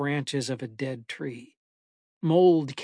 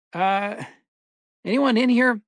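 higher, louder tentative call; 7 semitones higher, 7 dB louder than usual. A narrator's reading. Narrator calls into a seemingly empty restroom, calling out. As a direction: call out to see if anyone's there. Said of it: "I called out".

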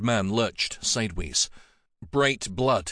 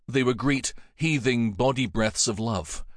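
ordinary delivery

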